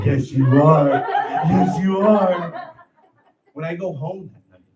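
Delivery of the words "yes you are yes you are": put-on louder sing-song baby talk; baby talk praise. Supervisor cooing at the big strong mailman, mock baby talk. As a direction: coo it like praising a toddler. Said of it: "I need my supervisor to look at me and say".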